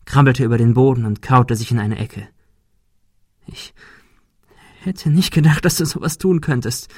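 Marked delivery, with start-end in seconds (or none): 3.5-6.9 hoch und verletzt, leise klagend; gekränkte hohe Stimme. A narrator's reading. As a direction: wie ein getroffenes Kind vorwerfen. Monika nach dem Schlag. (Monika wirft Ben nach dem Schlag gekränkt vor)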